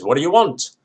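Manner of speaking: high, loud shout from the window; landlady shouting down. Retold or imitated. Landlady yelling at the man below. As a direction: bellow it down like a cross landlady. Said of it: "the landlady leans out and says".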